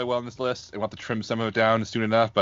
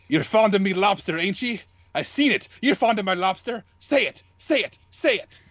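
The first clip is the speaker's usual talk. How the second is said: put-on higher-pitched old sea captain, growing more insistent; a grizzled lighthouse keeper demanding a confession, each "Say it" higher; Thomas Wake; 6 semitones higher than usual. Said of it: "I'm Thomas Wake".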